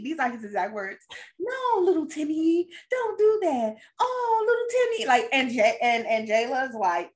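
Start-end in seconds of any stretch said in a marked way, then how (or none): 1.4-5.1 hammed-up gentle scolding, pitched way up. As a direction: coo at a child in a high, exaggerated lilt. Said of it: "they be like".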